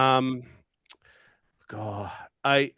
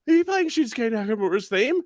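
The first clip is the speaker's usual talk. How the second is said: excited; 9 semitones higher than usual. Retold or imitated. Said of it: "ran up to me and just went".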